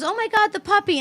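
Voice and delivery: put-on high-pitched little girl spotting a puppy; imitating excited child. Five-year-old daughter seeing the puppy, imitated high and excited. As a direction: mimic a small child's excitement.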